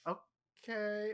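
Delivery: high, slowly stretched okay; uncertain, drawn-out agreement; retold baffled okay. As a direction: act out confused acceptance. Retold bewildered reply to angry gift-giving.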